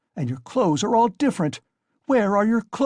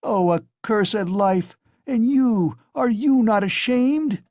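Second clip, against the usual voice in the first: accursed-life lament; 8 semitones higher, 5 dB louder, slower than usual. Acted. Wife attacks the guest.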